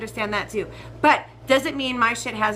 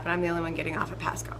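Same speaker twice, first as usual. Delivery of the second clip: whispered aside; 5 semitones lower, 7 dB quieter, faster than usual. Real, not acted. Whispered aside mid-story.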